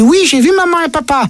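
put-on small child answering his mother innocently; voix d'enfant. Petit garçon parle à sa mère. (voix d'enfant, plus aiguë)

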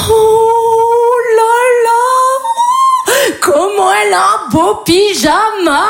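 very high, slow sing-song fawning; gushing visitors. Retold or imitated. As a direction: coo at a baby like a maternity visitor. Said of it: "ils sont là". (visiteurs de maternité qui roucoulent, très aigu et chantant)

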